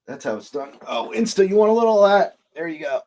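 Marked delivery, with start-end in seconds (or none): 0.9-2.4 playful offer. Real, not acted. Offering the pre-roll to Instagram viewers.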